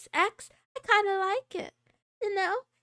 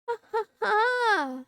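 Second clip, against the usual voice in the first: evil villain laugh; 7 semitones higher, slower than usual. Acted. Villain laugh after world-conquest line.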